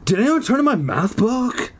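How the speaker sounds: put-on high-pitched questioning boy character; Zeke's voice. A narrator's reading. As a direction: ask it high, rising at the end. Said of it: "Zeke asked her".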